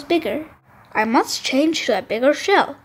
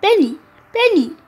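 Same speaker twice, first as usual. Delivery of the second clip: higher, louder calls into the sea; calling out; 7 semitones higher, 5 dB louder than usual. Acted. Lost Hermie calls for Benny on the ocean floor. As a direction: call his name across the water. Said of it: "he calls out".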